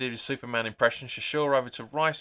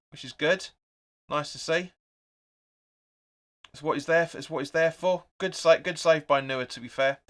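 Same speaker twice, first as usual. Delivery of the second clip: normal talking voice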